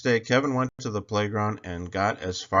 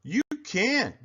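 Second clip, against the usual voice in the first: high bully bellow; 12 semitones higher than usual. A narrator's reading. Bully Sammy bellowing at Kevin. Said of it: "bellowed Sammy".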